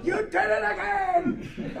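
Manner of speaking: high, loud, drawn-out comic accusation; mock outraged yell. Teacher replaying the shocked patient's protest, mock outraged. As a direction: yell it slowly as the zapped patient.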